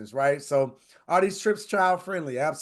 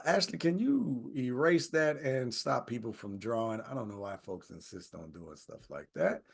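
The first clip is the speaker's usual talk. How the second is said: softer off-mic aside; hushed aside to Ashley; quiet aside; 5 semitones lower, 7 dB quieter than usual. Real, not acted. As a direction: mutter aside to the crew. Aside to staff.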